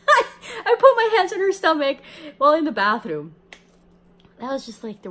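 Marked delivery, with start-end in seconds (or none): 0.0-3.4 loud laughing through the words. Real, not acted. Telling listeners about a bathroom healing, laughing loud. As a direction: crack up while telling it.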